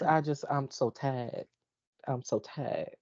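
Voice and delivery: slow, low and soft, worn out; quietly defeated; weary exasperation. Real, not acted. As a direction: let it sink into a tired, drawn-out murmur. Mock-exasperated by friends' teasing.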